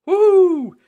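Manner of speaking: a high loud thrilled whoop; excited woo. A narrator's reading. Children thrilled about a surprise adventure, loud high whoop. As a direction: squeal with delight. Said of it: "gasped Peppa and George".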